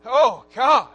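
loud, high, impassioned preaching; preaching at full force. Acted. Pastor preaching to his congregation, shouting with fervor. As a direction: pour it out over the congregation.

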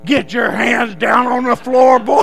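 slow, loud hollered command; sergeant's barked order. Acted. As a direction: holler it at a stiff recruit. Sergeant's order acted on volunteer.

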